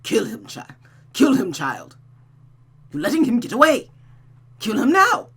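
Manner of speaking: urgent, rising command to kill; a hint of a cruel mother urging murder. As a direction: press her to strike now.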